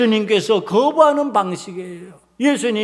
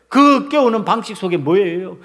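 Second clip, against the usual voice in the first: normal talking voice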